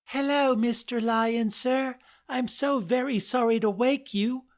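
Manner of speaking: high squeaky little mouse voice; squeaking mouse. Acted. Mouse apologizing to the lion she woke, high squeak. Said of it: "squeaked the mouse".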